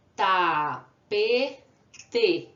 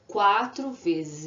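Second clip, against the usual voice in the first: "Quatro vezes" said slowly, counted; slow counted answer; 3 dB louder, much slower than usual. Shown as a demonstration. Teacher slowly counting the syllables of computador. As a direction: draw out the count for kids. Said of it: "Quantas vezes eu abri a boca".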